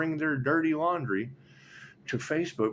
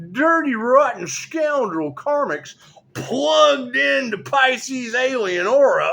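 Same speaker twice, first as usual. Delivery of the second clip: drawn-out, raised-voice declaiming; high, loud exclaiming; 9 semitones higher, 7 dB louder than usual. Acted. Reader addressing the Irish girl in Scotland, loud declaiming. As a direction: proclaim it high and loud, stretching key words.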